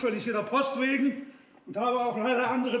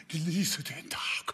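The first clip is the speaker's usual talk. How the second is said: soft whispered aside; 7 semitones lower, 6 dB quieter, slower than usual. Acted. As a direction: breathe it out secretly. Geflüstert nach Zitat. (geflüstert)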